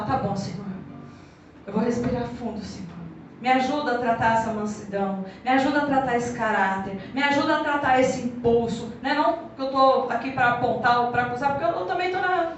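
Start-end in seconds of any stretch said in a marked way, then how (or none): 0.0-8.9 oração contrita. Retold or imitated. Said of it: "Aí você fala".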